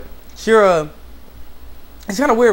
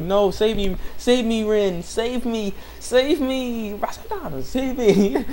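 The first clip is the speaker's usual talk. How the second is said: higher-pitched, repeated imitation cries for help; mock pleading; 7 semitones higher, much slower than usual. Yukio begging Rin to save him, mock desperation. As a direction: plead with growing hammed-up need.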